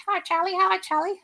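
high, loud squawking parrot imitation; cockatoo voice. Imitating the zoo cockatoo greeting its keeper, high loud squawk. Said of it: "So he s-, said to me".

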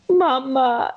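high, gasping mock sobs into "Mama"; imitated sobbing toddler. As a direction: gasp like a toddler worn out from wailing. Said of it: "she goes".

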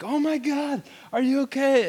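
high, frantic, imitated driver; panicked driver. Driver who hit the boy asking if he's okay, panicked. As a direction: play the shocked driver rushing over. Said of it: "he's like".

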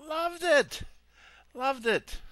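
high, loud gushing burst; excited. Real, not acted. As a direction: belt it out with delight. Gushing about former love of shrimp.

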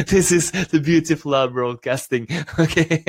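amused laughing aside; laughing while talking. Real, not acted. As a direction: say it still laughing. Laughing through comment.

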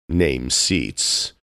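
ordinary delivery

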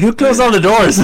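high, loud retold outcry; mock exasperation. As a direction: call out in playful indignation. Retelling frantic dash through house.

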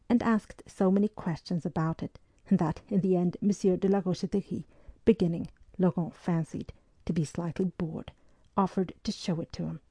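ordinary delivery

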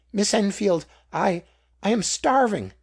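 high, loud, faltering plea for help; starving beggar's plea. A narrator's reading. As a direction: beg her, stammering with hunger. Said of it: "answered the beggar".

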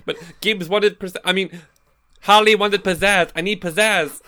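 high, loud showy character voice; bright hammed-up pizzazz demand. Player in character wanting pizzazz.

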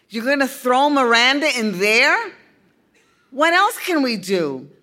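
put-on high, loud shocked young boy; a boy protesting in alarm. A narrator's reading.

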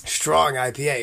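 talking right after a sip; swallowing between words; while sipping a drink. Real, not acted. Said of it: "I'm gonna take a sip".

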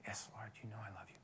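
a quick whisper; whispered Peter reply. Acted. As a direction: whisper the answer, ashamed. Peter answers again.

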